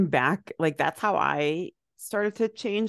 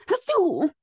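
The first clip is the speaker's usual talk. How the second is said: fake sneeze; 13 semitones higher, 3 dB louder than usual.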